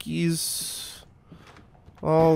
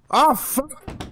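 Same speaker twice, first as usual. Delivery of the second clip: high startled yelp of real fright; 13 semitones higher than usual. Real, not acted. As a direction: jolt up high in sudden fright. Jump scare reaction.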